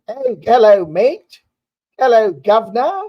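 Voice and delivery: high, loud mock Cockney "hello mate"; mock British accent. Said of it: "I don't make fun of your accent".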